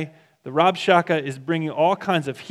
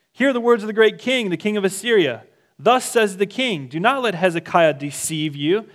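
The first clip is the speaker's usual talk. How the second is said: higher, louder herald's call; loud proclamation; 6 semitones higher, 4 dB louder than usual. Retold or imitated. Envoy shouting to the people on the wall, loud call. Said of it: "called out in a loud voice".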